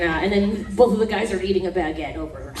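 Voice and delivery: usual speaking voice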